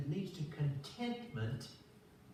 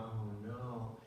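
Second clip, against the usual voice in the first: inner dread; 5 semitones lower, 6 dB quieter than usual. Retold or imitated. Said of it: "inside I'm going".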